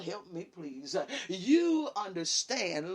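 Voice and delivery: ordinary talk, nothing marked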